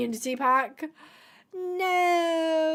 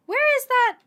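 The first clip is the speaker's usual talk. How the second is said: high squeak; 12 semitones higher, 6 dB louder than usual. Real, not acted. Searching coats, sudden squeal.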